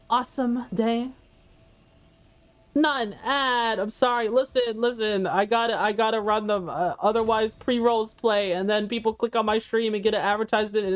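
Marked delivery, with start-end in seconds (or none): none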